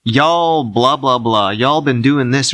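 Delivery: lightly put-on scolding tone; mock scolding. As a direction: imitate someone telling people off online. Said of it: "sort of scold people".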